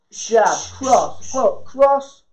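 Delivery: snappy combo calls on the beat; boxing coach calling combos. Real, not acted. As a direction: call the punches in rhythm. Calls combo during round.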